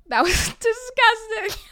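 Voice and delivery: real laugh breaking through, pitched high, slow. Real, not acted. To listeners, laughing at her own fall. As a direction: laugh as the word comes out.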